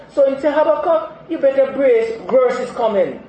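loud, high, urgent warning; fiery preacher warning the flock; preaching fire. Real, not acted. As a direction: warn the congregation at full cry. Preaching warning at full force.

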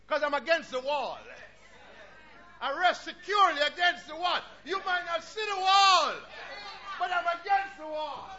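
loud, ringing pulpit voice; full-force sermon delivery; fiery preaching. Acted. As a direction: preach it out to the congregation. Preaches loudly.

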